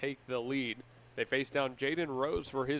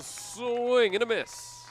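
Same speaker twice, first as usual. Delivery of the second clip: much higher and drawn out slowly; excited strikeout call; 10 semitones higher, 4 dB louder, much slower than usual. Real, not acted. Announcer calling strike three to listeners, slow excited call. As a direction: stretch the call as the batter whiffs.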